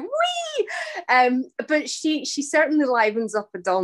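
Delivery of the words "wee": very high-pitched squeal of glee; imitating giddy swinging about; playful whee. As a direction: let out a drawn-out, soaring whee. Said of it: "and I'm going".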